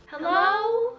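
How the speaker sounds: high, small, pitiful hello; pathetic helpless call. Retold or imitated. Calling out alone in the dark store, pitiful and helpless. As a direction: sound lost and helpless in the dark. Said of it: "most pathetic and helpless voice".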